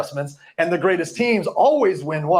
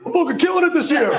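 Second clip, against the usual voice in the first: high, quick premature victory crow; mock boasting; 8 semitones higher, faster than usual. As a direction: crow it high and fast, hammed-up. Acted boast of premature winners.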